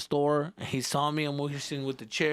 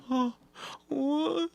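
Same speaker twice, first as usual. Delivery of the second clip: put-on high, whimpering lost-child panic; a kid realizing he's alone; startled kid; 13 semitones higher than usual. Lost kid realizing dad gone.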